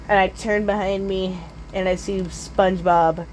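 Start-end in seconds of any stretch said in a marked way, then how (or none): none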